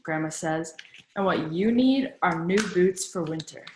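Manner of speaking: usual speaking voice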